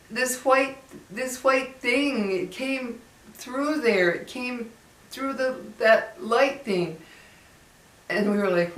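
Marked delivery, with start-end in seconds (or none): 0.1-7.0 put-on higher, slow frightened child's voice; scared little boy. Retelling the boy at the skylight, higher and slow. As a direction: play the spooked kid pointing up. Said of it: "And he goes".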